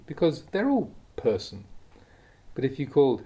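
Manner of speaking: ordinary delivery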